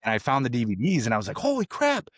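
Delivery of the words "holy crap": high-pitched retold astonishment; mock amazement. Son finding his lost DVDs, retold amazement. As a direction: exclaim it high, as at a sudden find.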